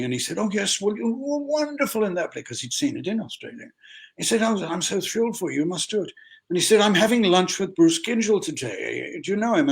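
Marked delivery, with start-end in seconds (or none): 0.4-2.4 high, gushing theatrical voice; gushing praise. Retold or imitated. Gushing actor praises his play. 4.4-9.7 gushing actor. Retold or imitated. Actor friend keeps gushing.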